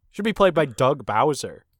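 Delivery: ordinary talk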